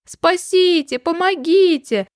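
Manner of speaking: high, drawn-out storybook plea; distant cry for help. A narrator's reading. Rabbit calling from the birch top, high distant cry. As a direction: call from afar in mock distress. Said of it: "раздался его голос издалека".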